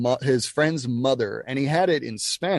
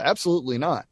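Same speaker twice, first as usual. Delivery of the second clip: usual speaking voice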